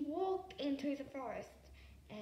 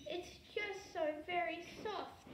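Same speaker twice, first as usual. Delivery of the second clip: sad dragon; 5 semitones higher than usual. A narrator's reading. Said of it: "The dragon sadly bowed his head".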